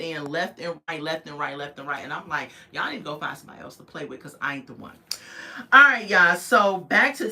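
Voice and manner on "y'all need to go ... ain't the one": quick dismissal. Retold or imitated. Quoted dismissal of trolls.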